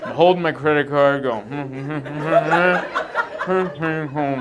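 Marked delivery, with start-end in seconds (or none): 1.4-4.4 humming the rhythm of an order; wordless order rehearsal. Retold or imitated. Waiting customer rehearsing his order, closed-mouth hums. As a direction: hum each item with your mouth shut.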